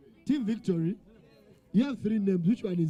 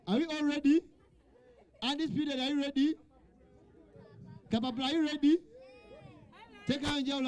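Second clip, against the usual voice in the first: higher-pitched roll-call to the teams; 4 semitones higher than usual. Real, not acted. Quiz host asks each team if ready, calling out higher.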